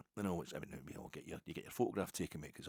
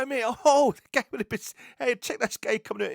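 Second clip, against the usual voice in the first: very high, much louder excited calling out; excited shout; 14 dB louder than usual. Retold or imitated. Telling his wife to look at the Besiktas fan, excited shout. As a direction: yelp it out like spotting a rival fan.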